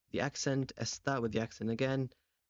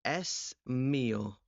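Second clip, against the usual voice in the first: slow example; much slower than usual. Shown as a demonstration. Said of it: "El móvil".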